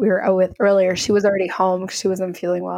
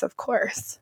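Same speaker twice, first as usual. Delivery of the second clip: whispered aside; 8 dB quieter than usual. Real, not acted. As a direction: drop to a breathy whisper for the aside. Aside after giving five stars.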